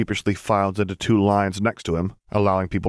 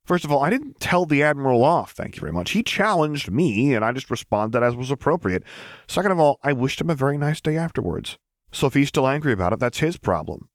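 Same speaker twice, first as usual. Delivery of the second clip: prim and proper, higher pitch; primly; 7 semitones higher than usual. A narrator's reading. Luke telling the troopers off, prim and higher. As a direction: lay it out primly, like a stiff captain. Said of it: "he told a lot of them primly".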